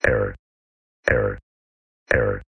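glitching robot. Acted. Robot malfunction repeats error.

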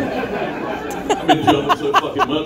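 breathless laugh, slow. Real, not acted. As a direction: laugh through the words. Laughing at his own joke.

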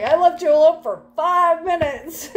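mock parental exasperation; exasperated parent. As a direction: reproach a grown adult like a child. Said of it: "Like, it's like".

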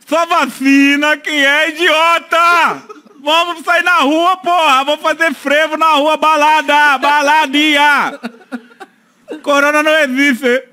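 high, slow hammed-up Bolsonaro rant; rally the crowd as Bolsonaro; Bolsonaro impression. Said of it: "isso é tudo ficção".

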